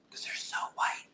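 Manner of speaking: hushed whisper. Real, not acted. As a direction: whisper it like a secret. Jokes about glowing teeth.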